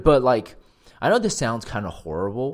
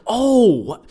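loud, high mock delight; fake happy surprise; 6 semitones higher, 7 dB louder than usual. Faking joy at mom's friend's gift, surprised and happy. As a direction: overact being thrilled by the gift. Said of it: "I acted so surprised and happy".

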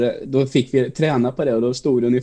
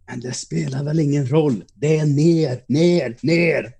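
higher-pitched mock yell, only a little forceful; raised pitch, exasperated retold outburst; mock exasperated teacher; 3 semitones higher than usual. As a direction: imitate a teacher snapping at a pupil. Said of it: "då skrek hon".

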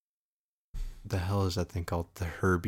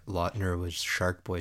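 ordinary delivery

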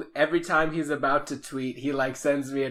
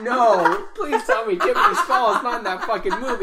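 louder, higher, laughing in mock horror; horrified laughing; 8 semitones higher, 8 dB louder than usual. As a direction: plead through laughter. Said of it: "I had this horror in my heart".

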